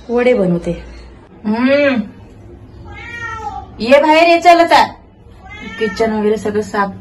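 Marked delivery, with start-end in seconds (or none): 1.4-4.9 high, loud coaxing call. Real, not acted. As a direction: coax the cat out. Calling the cat out.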